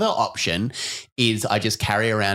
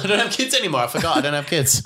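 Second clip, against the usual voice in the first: much higher-pitched mock denial; mock disowning mother; 8 semitones higher, faster than usual. Mocking a mother who refused her family, high-pitched playful denial. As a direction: put on a high voice, comically disowning.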